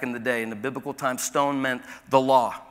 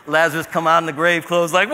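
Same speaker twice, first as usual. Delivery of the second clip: ordinary delivery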